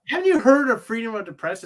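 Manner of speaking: loud, high, hurried mock jibe; smart-ass question. Fan mimics Superman's weak comeback to Zod, mock smart-ass jibe. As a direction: toss it out fast like a smart ass. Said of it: "what a smart ass would ask".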